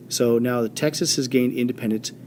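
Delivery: ordinary talk, nothing marked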